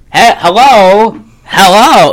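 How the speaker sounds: put-on slow, hearty old TV dad hello; fifties sitcom dad. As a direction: greet like a sixties sitcom father. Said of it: "He's doing, like, a very, like, fifties".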